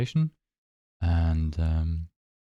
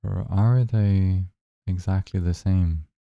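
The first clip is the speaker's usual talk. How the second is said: unhurried, even-toned guidance, leaving space between phrases; guided meditation. Acted. Guide asking listeners to notice their breath, calm guided meditation.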